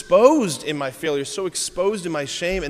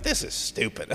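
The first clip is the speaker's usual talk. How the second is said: muttered under breath; 5 semitones lower, 6 dB quieter than usual. Retold or imitated. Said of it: "I'm like".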